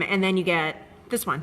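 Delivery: ordinary talk, nothing marked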